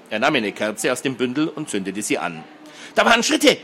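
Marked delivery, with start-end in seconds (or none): 2.9-3.6 high-pitched, alarmed cry; shrieking. A narrator's reading. Girl hearing footsteps on the stairs, shrieking. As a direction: squeal it out in alarm. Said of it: "kreischte Hedi erneut".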